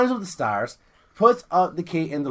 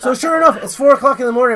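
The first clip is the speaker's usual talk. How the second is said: normal talking voice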